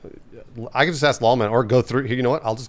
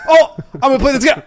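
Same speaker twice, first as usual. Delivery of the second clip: high, fast, excited put-on voice; chirpy self-parody, sped up; mock eager gamer; 12 semitones higher, 6 dB louder, faster than usual. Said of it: "which is I go".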